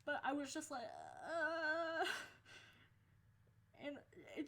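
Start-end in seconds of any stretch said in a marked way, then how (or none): 0.8-2.0 long, high "uhh" of confusion; helpless groan. Retold or imitated. Student lost in math class, high whiny groan. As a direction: whine it out, totally lost. Stuck student's reaction, acted out.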